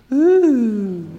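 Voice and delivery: high, playful "Ooh" of mock awe; a teasing, showy gasp of wonder; mock impressed ooh. Mock awe at fancy Greek.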